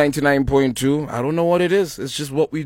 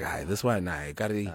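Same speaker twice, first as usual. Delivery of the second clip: soft, low imitation of someone whispering; retold whisper; 8 semitones lower, 12 dB quieter than usual. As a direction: quote it hushed, as if in someone's ear. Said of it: "She literally whispered in my ear".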